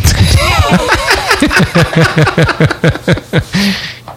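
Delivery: long high laugh; big laugh. Real, not acted. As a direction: burst out laughing. Laughing at own joke.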